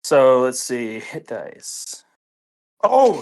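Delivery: usual speaking voice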